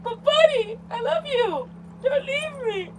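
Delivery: high, mock-heartbroken begging; pleading. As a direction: beg a pet to stay in a squeaky, hammed-up whine. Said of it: "Now I'm like".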